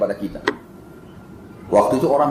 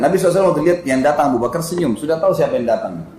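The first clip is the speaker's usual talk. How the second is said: normal talking voice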